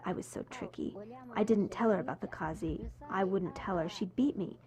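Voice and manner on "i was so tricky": soft, voiceless admission; naughty whispered aside; whispered confession. Real, not acted. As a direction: whisper it like a cheeky secret. Confessing aside about her mischief.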